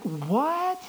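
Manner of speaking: high, slow, incredulous questioning; astonished Gideon. Acted. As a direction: disbelief climbing high in the voice. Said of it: "it's like".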